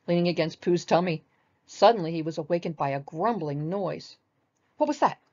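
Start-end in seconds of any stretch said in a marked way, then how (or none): 4.8-5.2 high, louder startled question; startled Piglet. Acted. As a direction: jump at a strange noise. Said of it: "asked Piglet, jumping up".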